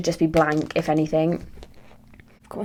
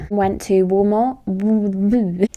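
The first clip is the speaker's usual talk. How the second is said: slow exasperation; 3 dB louder, much slower than usual. Real, not acted.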